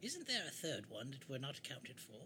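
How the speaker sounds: soft, slow in-character query; affected, softly puzzled adventurer. Acted. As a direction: ask slowly in a hushed put-on voice.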